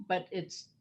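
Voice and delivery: normal talking voice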